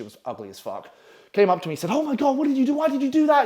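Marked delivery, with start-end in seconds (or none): 1.9-3.4 much higher imitation of a girl; mock-shocked, high-pitched questioning. Girl friend confronts him after the stunt, high and shocked.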